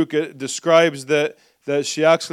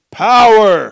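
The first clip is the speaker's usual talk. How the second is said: loud, high shout of one word; rallying shout; 6 semitones higher, 11 dB louder than usual. Real, not acted. Preacher prompts the congregation to say it back, rallying shout. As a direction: belt it out for everyone to repeat.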